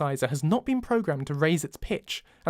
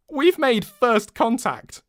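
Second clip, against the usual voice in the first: giggling; 8 semitones higher, 5 dB louder, much slower than usual. Acted. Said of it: "she giggles".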